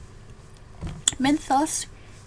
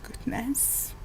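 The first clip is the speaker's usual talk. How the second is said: whispering; 7 dB quieter than usual. Real, not acted. Reacts to game enemies.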